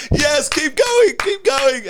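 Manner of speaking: high, slow drawn-out goading; mock yelling. Replaying a yelling match with an angry driver, goading shout. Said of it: "win a yelling match with him".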